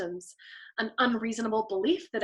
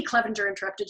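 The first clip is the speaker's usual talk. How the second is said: plain reading voice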